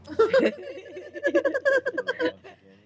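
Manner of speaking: high, loud shrieking laugh. Real, not acted.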